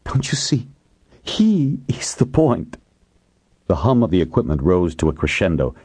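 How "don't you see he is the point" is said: high, tearful insistence; slow down as tears threaten; plead, close to breaking into tears; verge of crying. A narrator's reading. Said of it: "seemed on the verge of crying".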